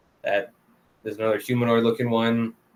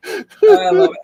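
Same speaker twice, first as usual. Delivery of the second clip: said through a high laugh; giggling delight; 12 dB louder, slower than usual. Real, not acted. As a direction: squeal it while laughing. Laughing after funny moment.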